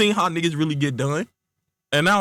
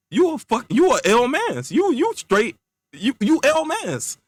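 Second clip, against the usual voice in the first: high, drawn-out accusation; indignant insult; 7 semitones higher, slower than usual. Acted. Casper insulting the friend who won't help, indignant. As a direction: stretch out the put-down, pitched way up.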